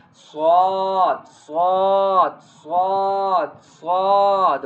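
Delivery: "Swad" with a long heavy vowel; bold pronunciation. Shown as a demonstration. Teacher drilling the bold letter, heavy drawn-out "swad". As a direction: repeat "swad" boldly, stretching each one. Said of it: "It is a bold".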